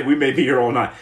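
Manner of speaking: ordinary talk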